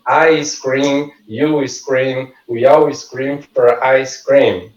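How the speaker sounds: I scream, you scream said slowly, word by word; a slow practice read of the twister; slow tongue twister. Shown as a demonstration. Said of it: "Essa frase".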